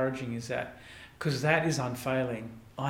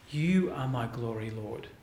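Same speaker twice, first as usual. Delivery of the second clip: softer and lower, speaking straight to God; hushed prayer; 6 semitones lower, 6 dB quieter than usual. Acted. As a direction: drop to a soft, lower, intimate prayer. Prayer quoted at end of sermon point.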